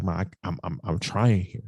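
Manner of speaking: low, soft, halting plea to Ma; understated retelling of breaking down; broken down, retold. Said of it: "I just broke down".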